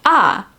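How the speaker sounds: "Ah" starting high, falling sharply; falling fourth tone. Shown as a demonstration. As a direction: drop "Ah" from top to bottom. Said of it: "also called the explanation tone".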